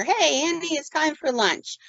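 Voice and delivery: high, slow, sing-song soothing; calming nurse. Retold or imitated. Nurse distracting agitated patient with lunch, slow and soothing. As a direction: coax her gently toward lunch. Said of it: "time to calm this situation down".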